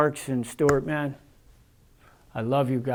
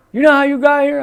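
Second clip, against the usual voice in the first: high, very loud confrontational question; imitated loud demand; 10 semitones higher, 10 dB louder, faster than usual. Officer grilling inmate who just woke up, imitated loud demand. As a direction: yell it like an officer grilling someone.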